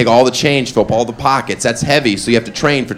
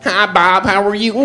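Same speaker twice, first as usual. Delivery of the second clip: lightly higher nerdy voice; 7 semitones higher than usual. Retold or imitated.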